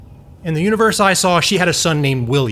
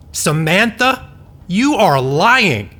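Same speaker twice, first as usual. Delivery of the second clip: disbelief; 6 semitones higher, much slower than usual. Acted. Disbelief at grandson's claim.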